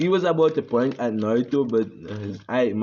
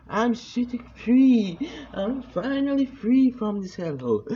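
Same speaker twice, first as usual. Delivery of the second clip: elated shout; 11 semitones higher than usual. Acted. Naruto celebrates escaping village.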